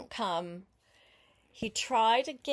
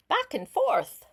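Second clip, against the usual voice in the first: louder and higher, shaking with drama; dramatic; 10 semitones higher, 7 dB louder than usual. Acted. Reader acting out the violent shaking, loud and high. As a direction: burst out as the strawberry shakes.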